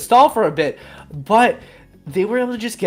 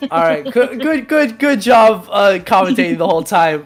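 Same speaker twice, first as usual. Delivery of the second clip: excited laughing; 3 semitones higher, 7 dB louder than usual. Real, not acted.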